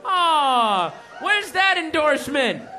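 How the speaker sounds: mock outrage. Real, not acted. Comedian jokes about the soda nickname, shouting. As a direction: holler for a sponsor deal. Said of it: "joking shout about sponsor".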